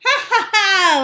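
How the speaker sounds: high loud jeering ha ha; loud mocking laugh. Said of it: "laughed the first little pig".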